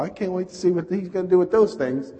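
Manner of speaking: high-pitched mock anticipation; amused skepticism. Diner watching friend order hush puppies, amused skepticism. Retold bemused thought at restaurant.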